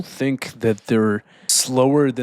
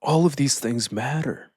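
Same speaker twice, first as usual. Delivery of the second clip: usual speaking voice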